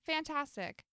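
dry deadpan fantastic; sarcastic. Retold or imitated. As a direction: say it flat and fed up. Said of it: "And I'm like".

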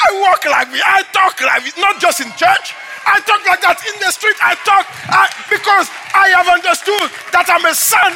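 shouted, high, fervent preaching; preaching at full force. Acted. Pastor shouting his testimony to the congregation, full-force preaching. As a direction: proclaim it to the whole church.

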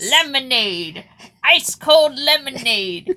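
loud, high vendor call; stadium vendor hawking. Retold or imitated. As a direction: hawk the lemonade to the crowd. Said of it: "the vendors going".

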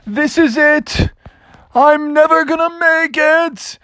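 high, slow wail of doom; despairing. Acted. Drowning bear says it to himself, high and despairing. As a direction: give up in a high, hammed-up lament. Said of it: "And he said to himself".

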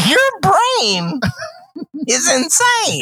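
very high, strained exclamation; exasperated outburst. Real, not acted. Host baffled by co-host's memory, high exasperated outburst. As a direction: cry it out in disbelief, pausing midway.

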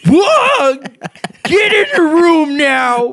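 high, loud alarmed yell; shouting. Acted. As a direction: yell the order in a panic. Staff yelling at patient.

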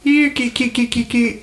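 high, repeated kissy "kee" calls; cat-beckoning noises. Pretend. Player beckoning a cat to cast a spell, high cat calls. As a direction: coax a cat over in high little syllables. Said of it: "bend down and say".

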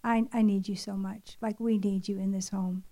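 low, soft pleading prayer; prayer under the breath. Retold or imitated. Drained mother praying as she sets the table, under her breath. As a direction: pray it privately, barely voiced up. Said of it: "praying under my breath in the Spirit".

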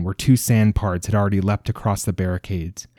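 plain reading voice

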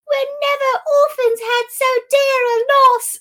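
very high, loud mock child's wail; orphaned children. Orphans lamenting a loss, mock shrill wailing. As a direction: squeal the lament like an over-acting child. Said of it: "the children".

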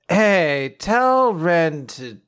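slow, sleepy mumble fading away; nodding off. Retold or imitated. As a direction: start dazed, then trail off as if passing out. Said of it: "Like, just passing out".

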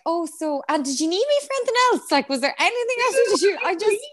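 high, fast and hesitant; awkward lingering date. Retold or imitated. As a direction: hover hopefully at the door. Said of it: "loitering at the door being like".

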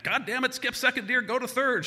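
high, loud exasperated yell; mom yelling. Retold or imitated. Mom yells at aunt driving to hospital, exasperated. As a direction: yell driving orders, imitating a frantic mother. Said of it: "I hear my mom yelling at my aunt".

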